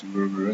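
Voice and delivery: "brr, brr" with soft English R; "brr, brr" with tongue curled back, untrilled. Shown as a demonstration. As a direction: show "brr, brr" as the English R not wanted. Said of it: "not like in English".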